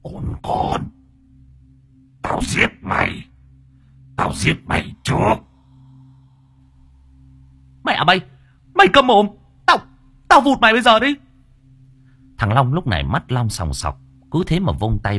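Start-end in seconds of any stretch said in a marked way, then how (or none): 0.0-5.3 rasping whispered menace; possessed spirit's threat. Acted. Spirit inside the woman threatening the worker, hissing menace. 7.8-11.1 high, loud screamed threats; furious shouting. Acted. Worker to the spirit while brandishing a branch, furious shouting.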